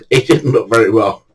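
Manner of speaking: ordinary talk, nothing marked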